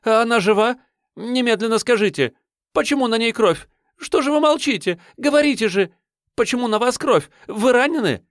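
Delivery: high, loud and frantic; rattling off. Acted. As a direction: pelt him with anxious questions. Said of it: "затараторила девушка".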